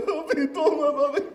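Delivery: very high, shaky laughing panic; a scared kid squeaking for his dad; terrified little boy. Retold or imitated. Terrified child bringing water. (niño aterrado con voz aguda)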